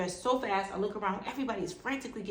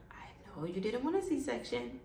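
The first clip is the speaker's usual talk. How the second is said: whispered; 5 semitones higher, 4 dB quieter than usual. Retold or imitated. Doctor breaks bad news.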